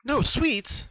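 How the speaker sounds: shocked. Acted. Twilight hearing Pinkie refuse sweets, high shocked question. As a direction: disbelief at the words. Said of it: "Twilight asked in shock".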